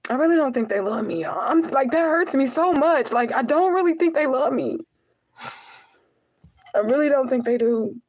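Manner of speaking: pleading, pained and high; hurt. Real, not acted. Telling viewers her family doesn't love her, hurt and high.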